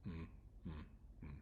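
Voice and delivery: "Mm" as a short closed-lip hum; two separate "mm" hums, no vowel; isolated M sound. Shown as a demonstration. Teacher introducing M to students, isolated humming sound. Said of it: "It also has one sound".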